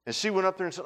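ordinary delivery

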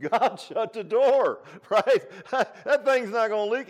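loud, high, laughing exclamation; laughing punchline. Retold or imitated. Preacher relaying a missionary's point about Noah, loud and laughing. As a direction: belt it out high through a chuckle.

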